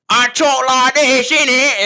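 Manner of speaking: put-on loud, high, drawn-out British accent; full-on British accent. Gamer fooling around with the boys, full-on British accent. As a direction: blast out an exaggerated British accent. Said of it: "going full on British".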